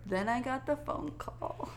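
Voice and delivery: hushed, ominous aside; dramatic whisper. Real, not acted. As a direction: whisper it like a plot twist. Dramatic turn in funny story.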